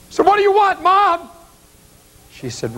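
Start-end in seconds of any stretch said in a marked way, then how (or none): 0.2-1.3 very high-pitched anguished call back; yelled reply. Retold or imitated. Son yells back to mother in mausoleum, high-pitched cry. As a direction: cry it back across the hall.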